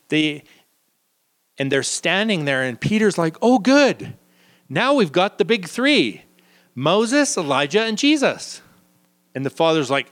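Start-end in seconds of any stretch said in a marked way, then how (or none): 3.4-8.6 high, eager "we've got the big three"; pleased disciple, voice jumps up; a lightly acted delighted Peter; excited Peter.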